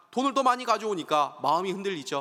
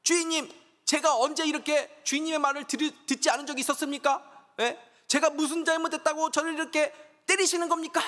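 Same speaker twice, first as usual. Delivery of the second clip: high, pleading donkey voice; the beaten donkey protests to its master, voice up high; 맞아서 억울한 나귀의 높은 하소연; talking donkey; 9 semitones higher than usual. Acted. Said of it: "나귀가 말을 하죠".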